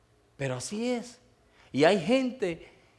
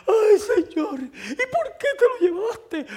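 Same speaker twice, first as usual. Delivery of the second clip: high, loud hammed-up funeral wail; mock wailing; 6 dB louder, slower than usual. Pastor mimicking wailing mourners at a Puerto Rican funeral, mock wailing. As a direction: imitate the mourners crying out to God. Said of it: "Y empezaron a gritar".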